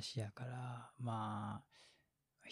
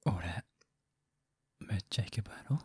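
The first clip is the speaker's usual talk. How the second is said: whispered mock heartthrob voice; hushed, show off a cool voice; Japanese, a whispered イケボ; ikebo voice; 3 semitones lower, 3 dB louder than usual. Shown as a demonstration. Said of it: "こんな声とか".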